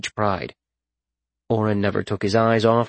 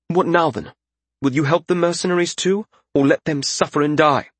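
high-pitched lordling voice; high, insistent questioning; demanding young lord; 7 semitones higher than usual. A narrator's reading. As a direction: demand an answer in a raised pitch. Young lord presses Reskin.

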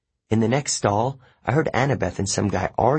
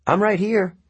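higher voice calling over; 11 semitones higher than usual. Acted. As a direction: call out to be noticed. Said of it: "Grover called".

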